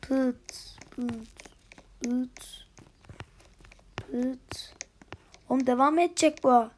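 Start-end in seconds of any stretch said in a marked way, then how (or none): 0.0-4.7 soft pit sounds. Real, not acted. Gamer chanting sound effect softly.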